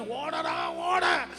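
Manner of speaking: high, excited cheering at a runner on TV; calling out. Retold or imitated. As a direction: yell encouragement at the screen. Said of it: "അറിയാതെ നമ്മൾ വിളിച്ചു പറയും".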